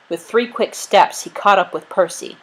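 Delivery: ordinary delivery, nothing marked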